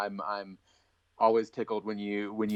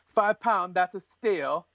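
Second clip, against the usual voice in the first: higher-pitched, hammed-up English accent; awful English accent; 7 semitones higher than usual. Co-host quotes the guy from Lock, Stock, awful English accent. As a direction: put on a bad English accent for a film line. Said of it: "awful English accent right there".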